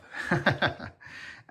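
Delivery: breathy laugh. Real, not acted. Laughs after saying he's breathless.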